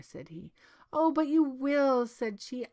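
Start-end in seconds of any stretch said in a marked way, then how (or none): 1.0-2.1 high, loud princess protest; worked-up, disbelieving objection; distrustful princess. A narrator's reading. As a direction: insist in a raised princess voice. Princess contradicts the minister.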